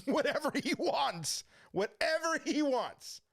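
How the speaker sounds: high, slow, eager-to-comply quote; comic retold submission, drawn out high; intimidated compliance. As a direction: give in carefully to a huge man. Agreeing to huge intimidating man.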